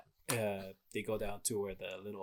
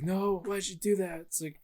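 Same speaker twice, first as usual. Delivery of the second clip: higher, anguished why; despairing Odin; 6 semitones higher than usual. Retold or imitated. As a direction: cry out in disbelief at the loss. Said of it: "Odin's just like".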